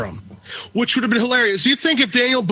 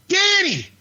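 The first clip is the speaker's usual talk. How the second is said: high, laughing mock mother calling; Daniel Boone's mom; 8 semitones higher than usual. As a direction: call out like a hammed-up pioneer mom. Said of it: "Daniel Boone's fucking mom going".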